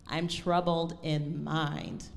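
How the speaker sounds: slow line, lingering on "mind"; slow, measured reading that settles on "mind"; resting on mind. Shown as a demonstration. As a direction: draw out "mind" and rest on it. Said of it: "we're resting on the word me, or sorry, mind".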